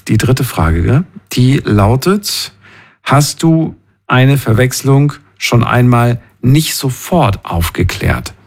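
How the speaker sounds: ordinary delivery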